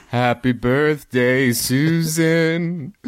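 very slow, odd crow voice. Pretend. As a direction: drag it out like a talking bird. A blind crow delivers message.

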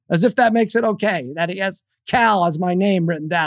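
ordinary delivery

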